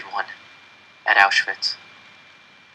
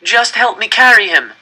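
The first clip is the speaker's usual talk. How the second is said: loud, high begging; 10 semitones higher, 7 dB louder than usual. A narrator's reading. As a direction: plead with him at full voice. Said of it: "I begged another man".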